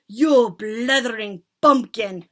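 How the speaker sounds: loud, slow furious screech; screeching insult. Acted. Witch leader screeching at the culprit, loud. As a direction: spit the insult. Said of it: "screeched the Grand High Witch".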